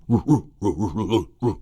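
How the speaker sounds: cartoon dog going ruh ruh ruh; dog noises. Teacher voicing the Great Dane stabbing the Constitution, doggy barks. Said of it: "He's going".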